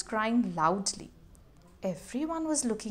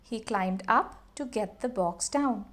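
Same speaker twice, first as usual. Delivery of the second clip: plain reading voice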